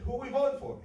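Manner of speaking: put-on old lady calling to her husband on the couch. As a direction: call the question over to him. Wife calls to husband.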